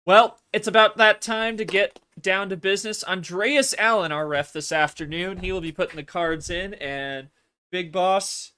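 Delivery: loud, high hype announcer. Acted. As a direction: rev up the crowd to start. Announcer kicks off the match.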